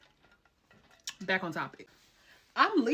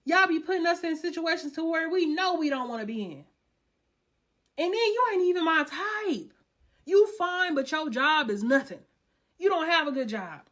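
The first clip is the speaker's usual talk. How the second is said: high, loud ranting; heated rant; 8 semitones higher, 5 dB louder than usual. Real, not acted. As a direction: call the men out, heated. Ranting at men.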